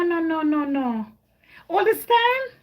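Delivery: normal talking voice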